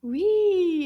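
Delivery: high, loud, stretched cheer; delighted confirmation. Real, not acted. Teacher confirms the class found it, delighted. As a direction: cheer the correct answer. Praising pupils' answer.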